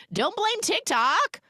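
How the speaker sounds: high-pitched indignant protest; mock indignant. Real, not acted. Viewer scolding the sister's excuse, mock indignant. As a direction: squeal it in exasperated objection.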